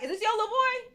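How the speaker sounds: high, loud retold question; calling out. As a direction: call over to another parent. Calling to another parent.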